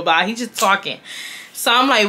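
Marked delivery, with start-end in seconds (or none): none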